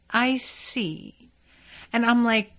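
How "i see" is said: a touch higher, mysteriously knowing; hint he truly sees inside her. Retold or imitated. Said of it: "You mean that literally".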